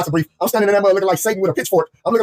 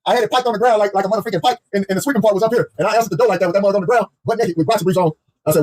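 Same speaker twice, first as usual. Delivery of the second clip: ordinary talk, nothing marked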